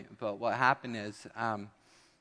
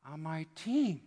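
lightly voiced proud kid; 7 semitones higher than usual. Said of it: "you're like".

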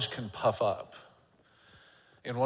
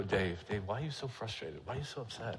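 mentor's gentle question; 2 semitones lower, 9 dB quieter than usual. Retold or imitated. Mentor voiced in story.

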